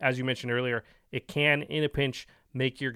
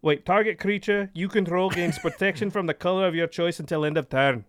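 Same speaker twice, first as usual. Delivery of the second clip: reading the card in a mock Scottish accent, a bit higher; 5 semitones higher than usual. Reading card text to co-host in mock Scottish. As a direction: keep the playful fake Scottish going. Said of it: "not your accent".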